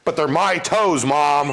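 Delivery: put-on high, slow, loud kid's whine of protest. Son arguing back to his mom, imitated indignant protest. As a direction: imitate a child objecting, words stretched out.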